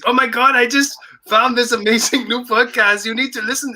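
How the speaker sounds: high, excited put-on voice; Portuguese friend voice. A fan telling his friends about the podcast, high and excited. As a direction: gush like a thrilled young fan. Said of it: "that's not Portuguese accent there".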